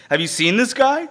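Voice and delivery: an incredulous "have you seen him"; astonished gasp. Retold or imitated. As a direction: gawk at the giant, wide-eyed. Same quote repeated, higher.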